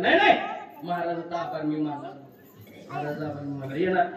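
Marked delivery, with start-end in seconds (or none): 0.0-0.4 alarmed no. Retold or imitated. Alarmed refusal.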